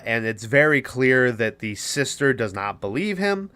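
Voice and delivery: ordinary delivery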